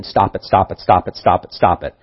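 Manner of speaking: ordinary talk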